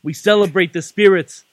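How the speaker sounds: put-on wrestling promo read in a Boston accent; Boston-accented cult-leader promo; Bray Wyatt in Boston accent. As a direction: preach it in measured phrases, punching key words, Boston accent. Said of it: "reading a Bray Wyatt promo in a Boston accent".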